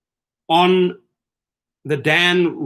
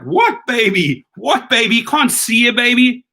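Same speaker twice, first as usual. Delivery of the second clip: baffled driver; 9 semitones higher, 4 dB louder than usual. Retold or imitated. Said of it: "And I'm like".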